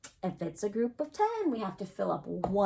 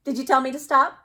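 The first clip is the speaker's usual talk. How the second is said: louder, higher playful mock surprise; 5 semitones higher, 10 dB louder, faster than usual. Teacher teasing the class who forgot to say stop, mock surprised. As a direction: act startled that nobody called stop. Kids didn't say stop in time.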